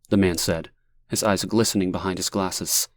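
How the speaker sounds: ordinary delivery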